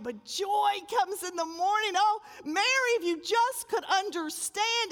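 loud, high, pleading sermon voice with a cry; tearful preacher's crescendo; impassioned preaching. Acted. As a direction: preach the hope into her. Preaching at full force.

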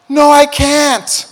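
put-on high, loud, slow kid's wail; whiny scared kid. Preston refusing the ski instructor, whining high. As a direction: whine it like a frightened six-year-old. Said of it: "Preston's over here going".

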